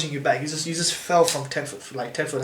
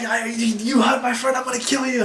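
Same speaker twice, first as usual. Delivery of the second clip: furious yell; 8 semitones higher than usual. Retold or imitated. Friend threatening the guards, a raging high yell. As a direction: roar it out at the guards.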